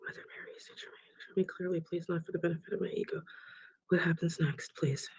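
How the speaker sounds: very soft, murmured plea; whispered prayer. Real, not acted. Medium asking Mother Mary for visions, whispered prayer. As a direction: pray under your breath. Medium prays to her guides.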